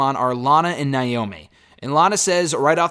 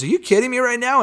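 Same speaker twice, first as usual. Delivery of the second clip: mock angry snap, pitched much higher; angry retort; 9 semitones higher, 3 dB louder than usual. Retelling Omos snapping at R-Truth, mock angry. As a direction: imitate a giant bodyguard's angry outburst. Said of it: "Omos got very angry and says".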